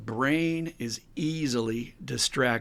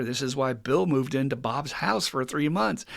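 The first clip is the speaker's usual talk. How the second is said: ordinary talk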